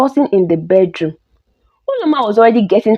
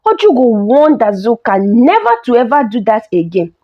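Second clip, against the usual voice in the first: plain reading voice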